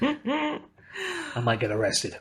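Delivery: a touch of Australian, high and soft; lightly put-on Aussie accent; mock Australian accent. Joking about his own Australian accent, softly. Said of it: "my Australian accent".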